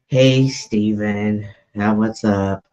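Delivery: low, unexcited greeting; unenthused. Real, not acted. Host greeting an unwelcome guest on stage, unenthused. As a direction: greet him with a real lack of enthusiasm. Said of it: "don't sound so enthused".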